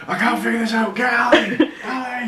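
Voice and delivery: loud high hammed-up yelling for Ali; mock shouting. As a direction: bellow for Ali, laughing. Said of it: "Why was it like".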